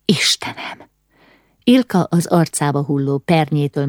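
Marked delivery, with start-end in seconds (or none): 0.0-0.9 breathy, whispered exclamation; hushed, voiceless shock; horrified gasp. A narrator's reading. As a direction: breathe it out in dread. Horror at the blaze.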